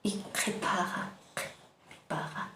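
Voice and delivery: qué paja whispered with relaxed mouth. Shown as a demonstration. Teacher whispering qué paja to show a relaxed mouth. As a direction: breathe qué paja out softly, lips loose. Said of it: "Así como relajando a sus labios".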